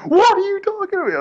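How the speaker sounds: very high squealed imitation of screaming; held-back mock scream. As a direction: mimic a scream without really screaming. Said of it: "I can't scream right now 'cause everyone's sleeping".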